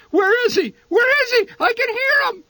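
very high, loud panicked shriek; frightened mother shrieking from the floor; terrified shriek. Acted. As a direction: shriek in terror of the bee. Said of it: "to shriek".

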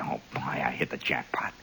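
whispered glee to yourself; secret whisper. Retold or imitated. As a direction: hush the delight so Leo can't hear. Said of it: "And you say".

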